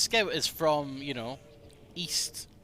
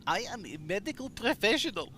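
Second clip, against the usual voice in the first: a hint of a German accent, higher; German Medic attempt; 6 semitones higher than usual. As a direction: try a German doctor voice, lightly. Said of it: "What the fuck is this accent?".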